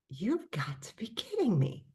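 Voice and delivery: re-enacted hushed amazement; whispered disbelief. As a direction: whisper it in mock astonishment. Retold astonishment on looking it up.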